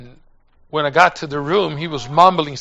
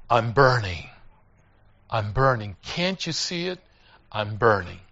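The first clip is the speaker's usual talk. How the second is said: low, subdued last words; dying words; 7 semitones lower, 6 dB quieter than usual. Retold or imitated. Dying John's last words to the preacher, low and spent. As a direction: let each phrase sink low and weak.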